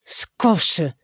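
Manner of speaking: choked-back "shut up"; muffled. A narrator's reading. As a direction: hold the outburst in, half stifled. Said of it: "είναι πνιχτό αυτή τη φορά". (πνιχτή φωνή)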